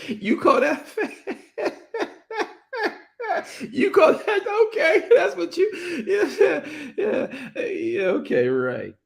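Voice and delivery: high, incredulous scoff with laughter; mocking God's voice. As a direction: laugh at the very idea, voicing God. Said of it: "That's why he said".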